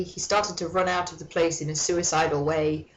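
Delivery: ordinary talk, nothing marked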